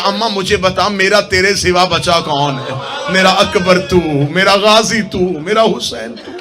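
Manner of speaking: slow, drawn-out acted lament; tearful cry, retold. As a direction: draw out each phrase like a grieving plea. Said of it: "रो के कहा".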